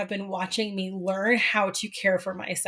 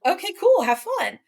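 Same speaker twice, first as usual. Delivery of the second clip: mock breezy okay; 10 semitones higher, 4 dB louder than usual. Mom breezily accepting daughter's plan.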